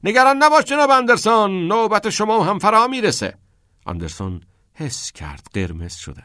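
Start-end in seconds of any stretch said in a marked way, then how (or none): 0.0-3.4 high, loud teacher's shout; calling out. A narrator's reading. Keating calls after Anderson heading for the dorm, shouting. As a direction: call after someone who is running off. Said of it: "که کتینگ فریاد زد".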